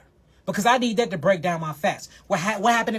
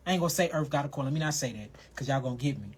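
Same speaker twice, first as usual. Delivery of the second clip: hushed aside; 8 semitones lower, 10 dB quieter, much faster than usual. Real, not acted.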